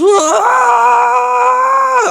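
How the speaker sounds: very high piercing mock scream; high shrill cry of pain; agonized scream. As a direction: shriek like your eyes are burning. Crowd screaming from pepper cloud.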